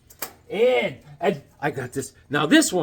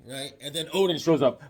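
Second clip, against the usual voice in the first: usual speaking voice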